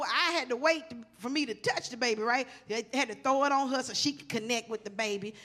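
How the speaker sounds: normal talking voice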